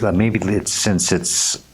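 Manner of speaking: ordinary delivery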